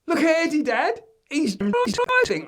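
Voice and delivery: put-on high, loud child voice; excited child. Joe calling to his dad about Eddie. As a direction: call to dad like an excited kid. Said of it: "And Joe says".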